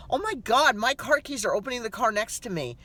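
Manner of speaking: high startled gasp at the keys; mock alarm. She relives pressing the wrong car's button, startled. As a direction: play your own panic, half-mocking. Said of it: "And I'm thinking".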